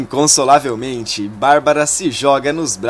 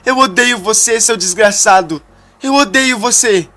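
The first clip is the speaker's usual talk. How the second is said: loud, high hysterical screaming; betrayed woman screaming at her fiancé; 10 semitones higher, 4 dB louder than usual. A narrator's reading. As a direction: scream your hatred at him. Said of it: "também grita".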